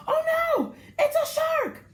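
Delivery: high, loud cry of alarm; excited shout. Acted. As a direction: yell it out like you just spotted a shark. Kids spot a shark, shouting.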